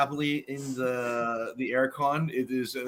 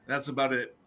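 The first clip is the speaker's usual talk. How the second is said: ordinary talk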